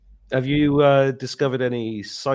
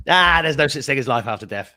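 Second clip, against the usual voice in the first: higher, louder mock dismissive mum; imitating mum dismissing; 4 semitones higher, 7 dB louder than usual. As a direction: play mum brushing it off. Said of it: "And then my mum goes".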